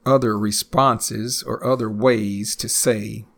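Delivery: ordinary talk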